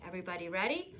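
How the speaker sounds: normal talking voice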